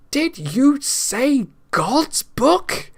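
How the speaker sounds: high and very slow, drawn-out demand; the king demands an answer from the monk; shouting king. A narrator's reading. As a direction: stretch it out like an enraged king. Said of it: "he shouted".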